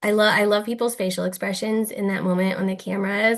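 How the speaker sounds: ordinary talk, nothing marked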